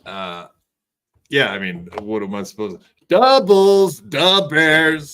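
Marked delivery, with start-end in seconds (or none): 3.1-5.1 loud, high Chicago superfan voice; hammed-up "da Bears" Chicago guy; Chicago accent. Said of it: "Do a Chicago impression for us".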